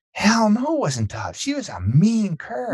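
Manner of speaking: high, emphatic imitation of the wife; mock indignant wife. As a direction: play the wife waving the idea off. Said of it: "And my wife is like".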